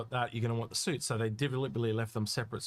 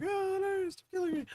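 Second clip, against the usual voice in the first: very high squeaky falsetto wail; mock agonised listener. Host voicing listeners with destroyed ears, mock agonised falsetto. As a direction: wail in tiny falsetto, playing at pain.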